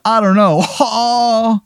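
high surfer shrug and odd laugh; creepy laugh surfer. Acted. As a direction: laugh like it feels great. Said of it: "And he goes".

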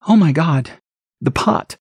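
high exclamation fading to a breathless the pot; shocked gasp. Acted. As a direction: react in dread. Stacy finds the broken pot.

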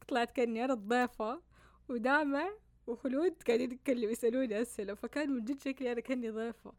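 laughing while telling it. Real, not acted. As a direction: giggle through the words. Amused recalling being like a guest.

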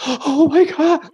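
high, slow, stunned gasp of a crowd; shocked crowd. Retold or imitated. Comic imitating the stunned audience, high and slow. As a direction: imitate the audience in horror, drawn out. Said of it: "people just like".

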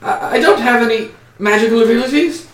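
a little gnome pleading innocence; nervous gnome. Acted. Gnome denying magic to the official, high and nervous. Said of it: "and he's like".

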